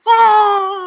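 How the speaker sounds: long, high, loud held "ah"; sustained crowd-style cheer, pitched well up; cheering cry. Pretend. Host hails his guest after the big introduction, cheering cry.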